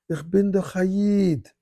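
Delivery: high, slow, drawn-out Yiddish; Yiddish accent. Retold or imitated. Guard answering the puzzled rabbi, high Yiddish accent. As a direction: insist in Yiddish, stretching it out. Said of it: "again in perfect Yiddish".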